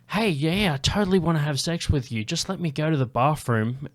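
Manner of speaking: high, pushed mock eagerness; fake enthusiasm. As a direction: act overly keen while planning an escape. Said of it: "you can fake enthusiasm".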